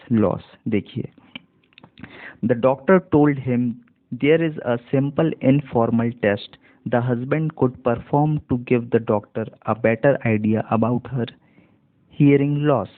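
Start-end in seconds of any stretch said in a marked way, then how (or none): 2.4-12.9 slow model reading. Shown as a demonstration. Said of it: "इसी तरीके से आपको भी धीरे धीरे बन जाएगा".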